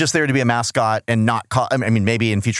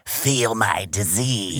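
creepy demon quote; 5 semitones lower, 4 dB quieter, much slower than usual. Retold or imitated. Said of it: "as she keeps putting it".

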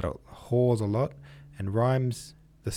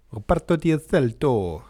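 louder, rolling Italian accent on Reparto di Assalto; full Italian accent on Reparto di Assalto, said louder; 6 semitones higher, 8 dB louder than usual. Shown as a demonstration. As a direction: say it like a proud Italian. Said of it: "I can do that accent because I'm half Italian".

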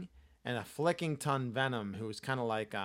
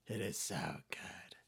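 whispering; 6 semitones lower, 11 dB quieter than usual. Real, not acted. Whispered praise.

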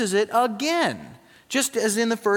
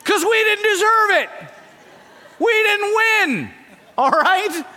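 loud, high, laughing through it; laughing shout; 13 semitones higher, 6 dB louder than usual. Real, not acted. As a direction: laugh while you hammer it home. Laughing while preaching loudly.

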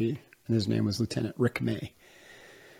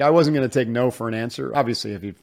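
normal talking voice

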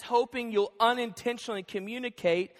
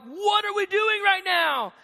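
higher, slow, pointed question; exasperated dad; 9 semitones higher, 4 dB louder, slower than usual. Retold or imitated. Dad correcting his interrupting daughter, exasperated. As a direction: stop her in her tracks, higher and slower.

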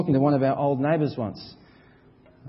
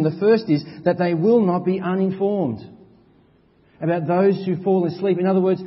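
higher, louder preaching, slowing on the questions; full-force preaching; 6 semitones higher, 6 dB louder than usual. Acted. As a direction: preach with rising urgency.

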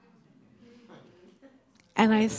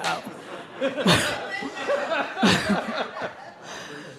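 light, high rising laugh; 3 semitones higher, 3 dB quieter than usual. Real, not acted. Preacher laughing with the congregation. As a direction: let out an amused laugh.

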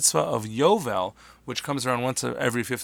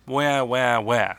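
three drawn-out louder wails; wailing; 5 dB louder, much slower than usual. Shown as a demonstration. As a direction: wail like a crying child. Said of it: "but a wail".

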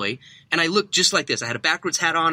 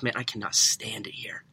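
hushed, secretive whisper, softer; retold whisper; 3 semitones lower, 6 dB quieter than usual. Employee confiding to undercover customer, whispered. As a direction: whisper it so the boss won't hear. Kid got quiet, looked around.

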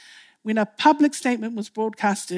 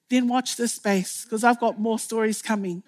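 normal talking voice

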